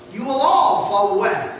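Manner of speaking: ordinary talk, nothing marked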